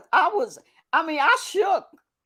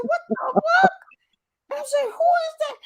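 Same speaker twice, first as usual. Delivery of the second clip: high, incredulous questions; stunned who-is-that; retold disbelief; shocked exclamation; 11 semitones higher than usual. Quoting own shocked reaction.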